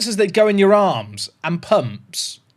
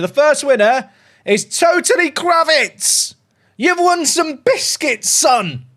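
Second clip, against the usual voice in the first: higher, slow, drawn-out reveal; winner announcement; 7 semitones higher, slower than usual. Real, not acted. Streamer announcing the giveaway winner to chat, slow excited reveal. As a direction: announce the winner like a game show host.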